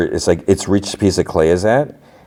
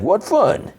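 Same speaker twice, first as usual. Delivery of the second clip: sarcastic. Retold or imitated. Luthen's dry quip retold.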